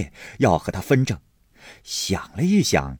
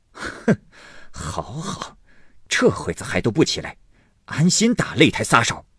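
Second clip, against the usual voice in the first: sarcastic, rising to annoyance; cold sneer; 5 semitones higher than usual. Acted. Husband back home to find servants asleep, sneering coldly. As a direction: scoff first, then let the irritation build. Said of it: "冷笑道".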